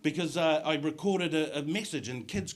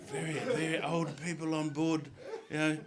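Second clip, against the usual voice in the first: usual speaking voice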